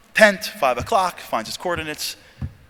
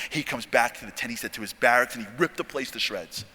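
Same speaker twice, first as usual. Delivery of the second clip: ordinary delivery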